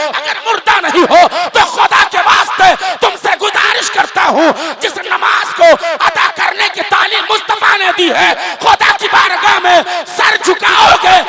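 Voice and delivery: loud, high-pitched impassioned shouting; full-force preaching. Real, not acted. Preacher pleading with the congregation to pray, full-force shouted preaching. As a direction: thunder the sermon out to a climax.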